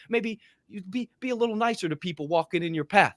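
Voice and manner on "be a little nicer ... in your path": usual speaking voice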